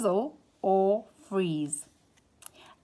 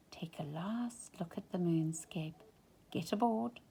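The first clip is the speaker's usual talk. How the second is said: whispered farewell line; quiet whispered aside to the listener; soft whisper; 8 dB quieter than usual. A narrator's reading. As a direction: whisper it like a last goodbye. Farewell look at the moon.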